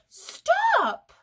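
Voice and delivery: high, loud shout; sudden halt. Acted. Storyteller halting children running away, high shout. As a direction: call a halt with a yelp.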